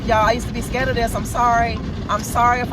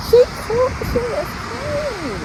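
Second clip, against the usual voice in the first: very high, slow, gushing delight; slower than usual. Real, not acted. Admiring her freshly washed car, she gushes with delight.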